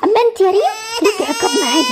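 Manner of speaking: ordinary talk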